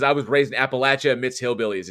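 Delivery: usual speaking voice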